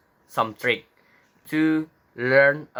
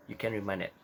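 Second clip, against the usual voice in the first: ordinary talk, nothing marked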